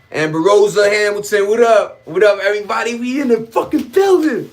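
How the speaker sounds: loud, high hype greeting; hype shout-out. Real, not acted. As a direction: pump up the crowd.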